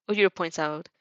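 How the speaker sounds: usual speaking voice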